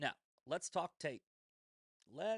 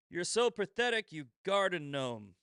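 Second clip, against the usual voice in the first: louder, higher mocking read; 3 semitones higher, 7 dB louder than usual. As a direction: ham up the insult. Reading insult aloud.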